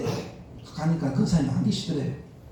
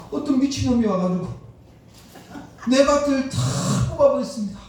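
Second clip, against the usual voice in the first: put-on louder, higher tearful wail of a distraught old lady; mock weeping report, raised and loud; retold crying complaint; 5 semitones higher, 5 dB louder than usual. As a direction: imitate a deacon wailing that her field was ruined. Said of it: "막, 막 울더래요".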